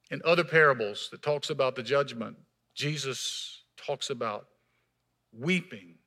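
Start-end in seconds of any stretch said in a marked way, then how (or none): none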